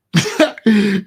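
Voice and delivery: a loud, sudden laugh; loud burst of laughter. Real, not acted. Laughing out loud at the story for the co-host. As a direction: laugh out loud at what she said.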